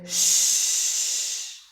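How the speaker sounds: long voiceless sh, like a hush; sh without vibration. Shown as a demonstration. Teacher showing no throat vibration, a long hushed sh. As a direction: hold sh on breath alone. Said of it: "no vibration here".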